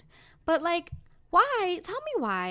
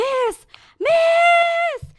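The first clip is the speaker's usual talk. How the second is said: much higher, louder call across the street; yelling after a woman on the street; 11 dB louder than usual. Retold or imitated. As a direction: shout after a stranger to stop her. Calling out to stranger on street.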